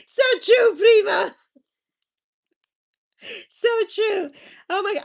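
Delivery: high, loud laughing "so true"; delighted high-pitched agreement. Real, not acted. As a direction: shout it through laughter.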